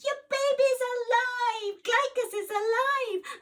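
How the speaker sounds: high, loud exultant shout; joyful shout. Acted. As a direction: scream the good news. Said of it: "Polydus screamed and shouted".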